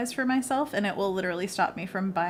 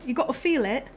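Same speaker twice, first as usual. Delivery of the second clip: higher-pitched fake British accent; hammed-up British art teacher; bad British accent; 5 semitones higher than usual. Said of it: "She would always put on a really bad".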